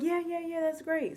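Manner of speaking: high, breezy imitation of keen hosts; acted-out approval, up high; eager agreement.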